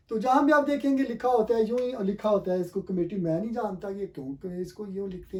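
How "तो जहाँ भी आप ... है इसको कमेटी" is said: ordinary delivery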